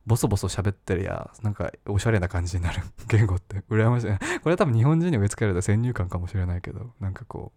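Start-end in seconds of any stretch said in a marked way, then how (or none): none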